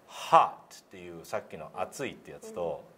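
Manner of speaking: normal talking voice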